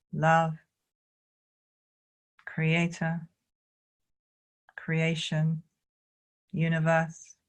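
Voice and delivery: calm, measured guiding with long pauses; spacious meditation instruction; guided qigong meditation. Real, not acted. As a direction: lead them gently through each movement, unhurried.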